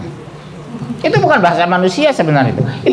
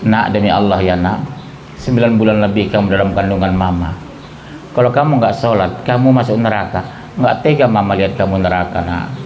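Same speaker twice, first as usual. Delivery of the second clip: gentle mother; 9 semitones lower than usual. Shown as a demonstration. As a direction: coax your child tenderly. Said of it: "Lembut sama anak".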